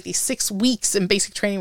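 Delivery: ordinary delivery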